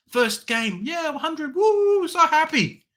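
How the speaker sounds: sarcastic fan whoop; mock excitement. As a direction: ham up the early joy. Mocking his own early excitement.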